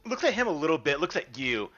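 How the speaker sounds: ordinary delivery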